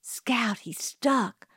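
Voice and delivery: hushed, whispery alarm; half-voiced frightened gasp of words; breathed words. A narrator's reading. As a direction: whisper it in horror. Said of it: "breathed Jim".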